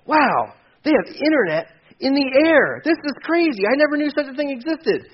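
high, excited wonder; amazed. Retold or imitated. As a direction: gush like a first-time flyer. Comedian bit, amazed passenger.

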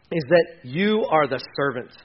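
ordinary talk

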